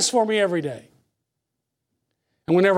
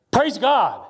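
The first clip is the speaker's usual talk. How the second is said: ordinary delivery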